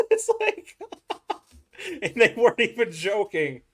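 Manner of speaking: talking through helpless laughter; high, loud, laughing as you talk. Real, not acted. Laughing at his own story.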